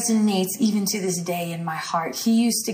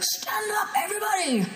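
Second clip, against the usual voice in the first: high-pitched mock roar; strained, much higher mock shout breaking into laughter; imitated roar; 12 semitones higher than usual. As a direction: roar it like a big preacher, without full volume. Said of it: "he would just roar".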